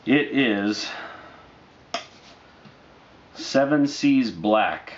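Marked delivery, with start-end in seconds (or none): none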